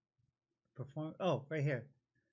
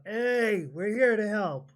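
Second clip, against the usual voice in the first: loud, higher-pitched shout into the house; bellowed call; 6 semitones higher, 5 dB louder, slower than usual. Acted. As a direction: bellow it out to anyone trapped inside. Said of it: "I'll just bellow out".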